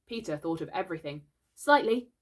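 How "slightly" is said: raised, higher summons; loud commanding call. A narrator's reading. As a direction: call the boy over. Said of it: "he cried".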